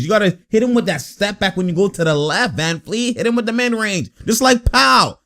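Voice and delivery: usual speaking voice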